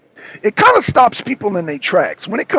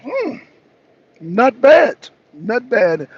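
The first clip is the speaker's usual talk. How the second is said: high hum then a pleased not bad; lightly voiced Putin, impressed; smug mock approval; 5 semitones higher, 4 dB louder, much slower than usual. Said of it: "Putin is like".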